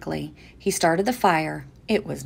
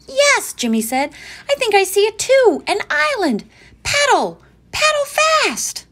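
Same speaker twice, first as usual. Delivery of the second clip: put-on high urgent boy voice; excited child; 8 dB louder than usual. A narrator's reading. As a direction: urge them on excitedly. Jimmy urgent at sea.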